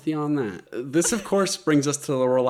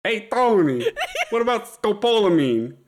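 put-on higher, louder Brooklyn accent; laughing through a Brooklyn accent; 10 semitones higher, 4 dB louder than usual. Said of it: "okay, I'm doing that".

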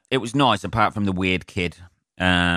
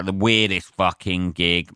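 ordinary talk

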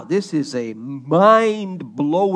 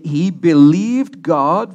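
"believed" punched loud and drawn out; stress on BELIEVED; 7 dB louder, slower than usual. Shown as a demonstration. Preacher quoting Paul on Abraham, heavy emphasis on "believed". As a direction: lean hard and slowly on "believed". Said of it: "Emphasis on the word belief".

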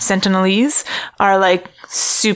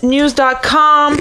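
usual speaking voice

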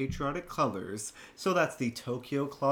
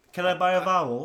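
ordinary talk

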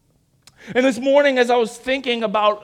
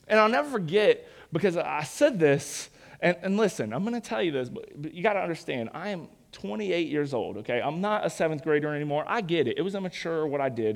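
ordinary talk

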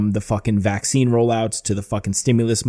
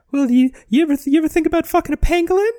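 very high, fast squeaky cartoon mouse; high-pitched Mickey impression, louder; 5 dB louder, faster than usual. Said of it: "And he's like".

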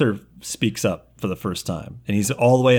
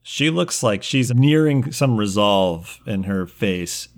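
usual speaking voice

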